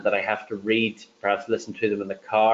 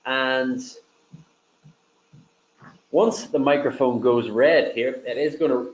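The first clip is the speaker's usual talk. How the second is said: normal talking voice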